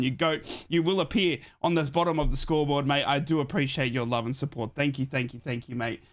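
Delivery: ordinary delivery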